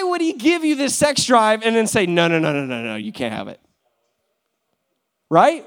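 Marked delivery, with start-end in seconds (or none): 2.0-3.6 low, hushed no no no from above; stern refusing voice. Retold or imitated. Speaker voicing God denying the believer, a low stern refusal.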